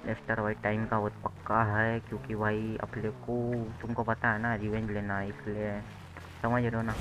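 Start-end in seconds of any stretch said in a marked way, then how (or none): none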